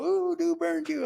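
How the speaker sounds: high, slow sing-song teasing ooh; mocking tease. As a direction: rib him like cellmates who just saw him get burned. Cellmates teasing him in church.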